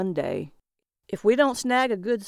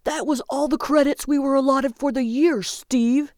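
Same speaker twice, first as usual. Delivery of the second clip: high, loud, alarmed protest; aghast; 7 semitones higher, 5 dB louder than usual. A narrator's reading. Calvin learns the year's credits are gone, aghast.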